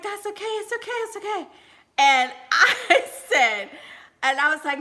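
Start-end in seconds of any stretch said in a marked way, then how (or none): 0.0-1.5 higher and softer, reassuring; soothing. Retold or imitated. Boyfriend calming her after she hurt her elbow, soft and high. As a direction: coo it gently, again and again.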